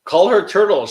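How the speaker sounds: loud playful insistence; emphatic repeat. Real, not acted. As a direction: push the nickname again, grinning. Repeats the nickname louder.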